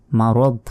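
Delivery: মারদ closed on a vowelless d; stopping with sukun. Shown as a demonstration. Teacher shows the word when stopping, মারদ with no final vowel. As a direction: end মারদ on a bare consonant. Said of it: "এভাবে উচ্চারণ হবে".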